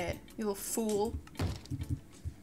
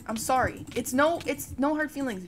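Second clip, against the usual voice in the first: ordinary talk, nothing marked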